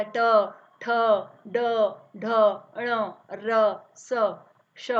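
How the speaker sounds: each letter apart with tongue curled back; sounding out retroflex letters. Shown as a demonstration. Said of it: "तो री बोलने में जो है हमारी जिह्वा मुड़ा". (मूर्धन्य वर्णों का अलग-अलग उच्चारण)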